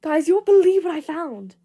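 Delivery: loud and high, bursting with news; excited yell to the room; overexcited shout. Acted.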